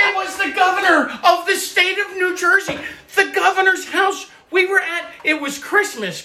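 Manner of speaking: high-pitched, insistent protest; indignant correction. Real, not acted. Dad corrects daughter about the governor's house, indignant.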